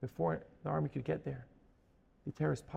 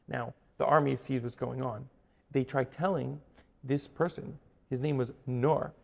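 ordinary delivery

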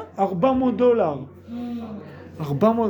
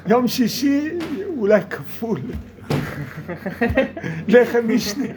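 ordinary delivery

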